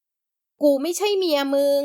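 put-on young woman quivering as she refuses him; trembling voice. A narrator's reading. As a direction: tremble through the denial. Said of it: "เอ่ยเสียงสะท้าน".

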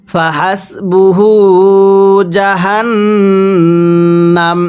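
slow, drawn-out tajweed chant; Quran recitation. Shown as a demonstration. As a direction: recite it slowly, stretching the vowels. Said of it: "কিভাবে পড়ব?". (ধীরে টেনে কুরআন তিলাওয়াত)

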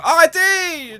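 loud high yell to halt; shout. Real, not acted. Gamer yelling at his builders to stop, loud. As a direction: stop them dead.

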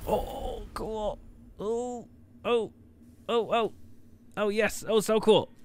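high, soft awed oohs and ohs; hushed excitement. Real, not acted. Player watching the new build appear, quietly excited. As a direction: coo it high and quietly, thrilled. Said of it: "I'm kind of excited".

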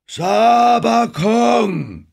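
hoher, lang gedehnter Klageruf; klagend anbetend. A narrator's reading. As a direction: rufe es klagend und sehr langsam. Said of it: "ein klagendes, anbetendes Gemurmel". (laute, gedehnte Anbetung)

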